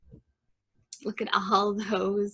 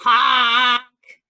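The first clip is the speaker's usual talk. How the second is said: loud, held honk; blaring horn imitation; yelled truck honk; 4 semitones higher, 7 dB louder than usual. A narrator's reading. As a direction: honk like a big dump truck. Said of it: "yelled a dump truck".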